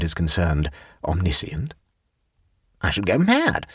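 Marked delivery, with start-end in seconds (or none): 2.8-3.7 high-pitched, emphatic declaration; high woman's voice, convinced; with conviction. A narrator's reading. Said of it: "said Jane with conviction".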